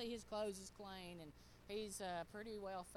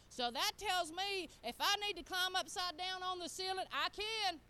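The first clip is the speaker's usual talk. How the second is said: fervent preaching; 6 semitones higher, 7 dB louder than usual. Real, not acted. Preacher declaring God's ability to the congregation, building loud. Preaches scripture at rising force.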